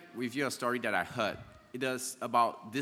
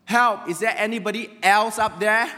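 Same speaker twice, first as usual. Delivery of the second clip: very loud, high yell upwards; shouted call for help; 12 semitones higher, 12 dB louder than usual. Retold or imitated. Man rejects God's offer and calls past him, loud desperate shout. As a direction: holler for anybody else to rescue you. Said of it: "And then he shouted".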